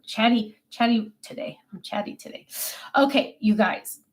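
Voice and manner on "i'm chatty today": soft unvoiced whisper; sheepish whispered confession to viewers; whispered admission. Real, not acted. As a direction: confide it under your breath. Repeats it whispered.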